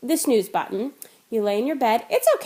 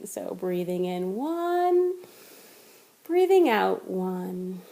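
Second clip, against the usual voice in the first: guided breath counting; 4 semitones lower, much slower than usual. Shown as a demonstration. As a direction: ease the listener into a slow count. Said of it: "We're thinking about breathing".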